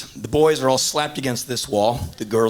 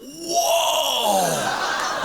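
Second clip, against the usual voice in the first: high shouted amazed whoa; crowd whoa; 10 semitones higher than usual. Retold or imitated. Whole room reacting to the banana catch, high amazed shout.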